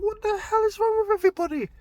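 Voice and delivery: high, loud outraged yell; yelling ringside at the cornermen; frantic shout. Retold or imitated. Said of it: "I'm shouting at his corner".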